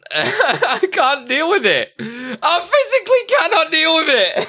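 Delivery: words squeezed out through real laughter; helpless laughter. Real, not acted. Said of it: "Oh, God, I'm dying".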